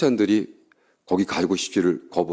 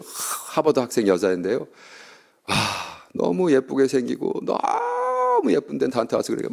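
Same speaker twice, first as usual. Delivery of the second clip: normal talking voice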